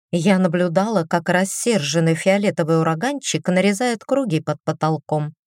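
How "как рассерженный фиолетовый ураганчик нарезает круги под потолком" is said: usual speaking voice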